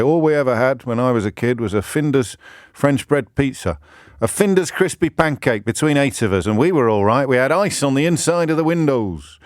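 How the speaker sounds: a light put-on northwest English accent, nostalgic; lightly voiced northern English old-timer reminiscing. Mocking Facebook posters in a light northwest English accent. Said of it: "went northwest there in my accent".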